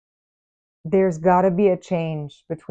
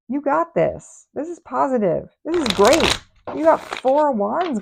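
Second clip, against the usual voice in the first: encouraging hype; 6 semitones higher, 3 dB louder than usual. Acted. Hyping up the viewer.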